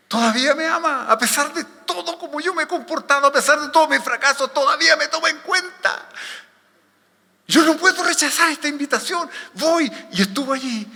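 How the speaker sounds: high, loud, fervent realisation; overjoyed amazement. Acted. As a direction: exclaim it high, astonished to be still loved. Voicing Peter's joy at being called. (asombro gozoso, agudo y fuerte)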